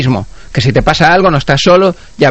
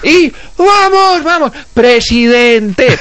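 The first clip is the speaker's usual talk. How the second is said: very high, slow, sarcastic cheering; mock crowd cheering; 10 semitones higher, much slower than usual. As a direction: hype him up like a fawning crowd. Mocking crowd cheering the impostor. (vítores burlones muy agudos y lentos)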